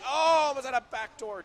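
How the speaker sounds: loud, high gasp of a call, easing off; excited outburst. Real, not acted. Commentator seeing a backdoor pass, excited outburst. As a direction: erupt at the sudden near chance. Surprised by a near chance.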